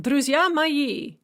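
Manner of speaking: "Друзья мои" with the second and fourth syllables stressed; iambic stress. Shown as a demonstration. Teacher reads an example line of Russian verse, iambic stress. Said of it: "is in iambic".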